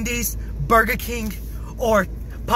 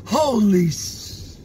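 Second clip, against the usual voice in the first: usual speaking voice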